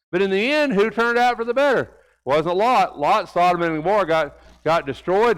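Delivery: lively preaching to the congregation. Acted. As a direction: lift the sermon with moderate force. Sermon builds again.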